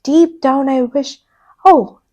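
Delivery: plain reading voice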